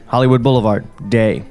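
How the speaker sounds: ordinary talk, nothing marked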